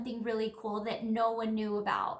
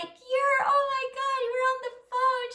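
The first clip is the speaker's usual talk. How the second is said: much higher and louder, overwhelmed with joy; tearful excited gush; 12 semitones higher, 6 dB louder than usual. Retold or imitated. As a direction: gush like you can't believe who's calling. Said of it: "She starts bawling".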